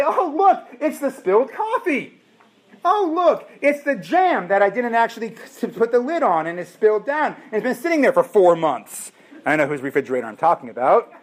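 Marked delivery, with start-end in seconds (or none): 0.0-9.1 high, squealing mock delight; playful mock-shocked voice; mock surprise. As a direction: gush in mock amazement at the mess. Mocking shopper at showroom fridge.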